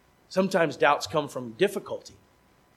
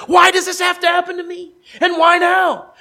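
despairing shout; 12 semitones higher, 11 dB louder than usual. Acted. Voicing believer's despairing cry.

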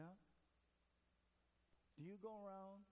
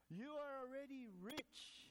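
slow, higher mock-impressed sneer; sarcastic Paul; 6 semitones higher than usual. Paul sarcastically tells the rich Corinthians, slow and higher. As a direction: draw it out with a raised sarcastic lilt. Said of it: "Paul goes on a little sarcastically".

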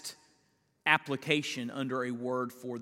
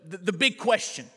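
full-force preaching; 8 semitones higher, 8 dB louder than usual. Acted. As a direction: hammer home that God provides. Preacher drives the point home.

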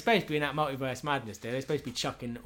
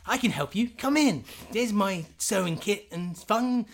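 much higher, louder chirpy doctor; mock doctor; 9 semitones higher, 4 dB louder than usual. Doc Smith stitching a soldier, much higher and chirpy. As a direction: breeze through the patching up.

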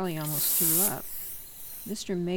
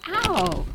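sharp high cry of pain; pained yelp; 11 semitones higher, 9 dB louder than usual. Acted. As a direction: yelp as you hit the ground. Boy falls after a shove.

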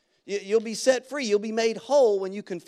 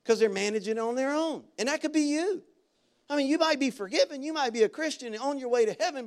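ordinary delivery

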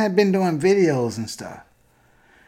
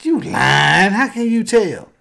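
incredulous friend; 6 semitones higher, 5 dB louder, slower than usual. Retold or imitated. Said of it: "a friend of mine was like".

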